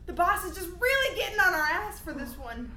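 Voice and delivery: high, loud frantic rambling; freaking out. Acted. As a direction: spiral into panic, fast at the end. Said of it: "I'm freaking out".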